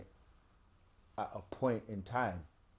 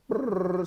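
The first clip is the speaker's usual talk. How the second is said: rolled lips spinning sound; 9 semitones higher, 8 dB louder than usual. Pretend. Spinning the lottery wheel.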